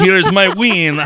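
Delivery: loud, high, drawn-out mock French accent. Shown as a demonstration. Man showing co-host his bedroom line, mock French accent. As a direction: ham it up as a French seducer. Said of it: "Did you say it with a French accent?".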